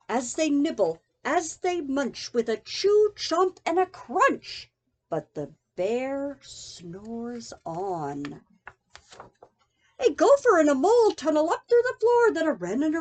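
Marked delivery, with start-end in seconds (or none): 5.1-8.4 slow drowsy refrain. A narrator's reading. Refrain read sleepily.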